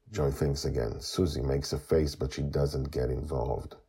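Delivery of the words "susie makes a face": ordinary delivery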